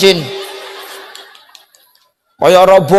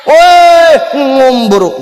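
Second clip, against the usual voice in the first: calling out; 6 semitones higher, 4 dB louder, much slower than usual. Acted. Preacher calling out to rich man.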